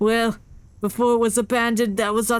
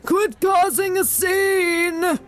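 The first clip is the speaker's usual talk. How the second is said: high character voice; 7 semitones higher than usual. Acted. Character line read in voice.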